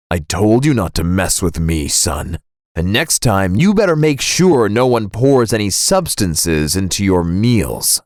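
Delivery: low-pitched, understated threat; lightly voiced dad, low and warning; menacing dad. Vengeful dad warns his son.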